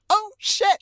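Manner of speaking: imitating Wesley's startled, high, loud yelp. Imitating Wesley pulling his hand away, startled yelp. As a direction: jump back like you touched a hot stove, loud and high.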